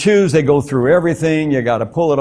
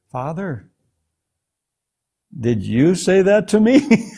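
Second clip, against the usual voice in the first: hushed, hesitant call, then a higher question; a little scared; 3 semitones higher than usual. Retold or imitated. Man asks God if he said that, retold a little scared. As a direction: retell timidly asking, with a long pause. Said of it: "I'm a little scared now".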